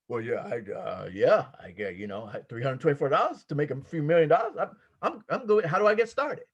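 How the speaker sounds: eager investor. Pretend. Prospect answering the pitch, low and hesitant. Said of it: "treat this like you have a multi-million dollar real estate project".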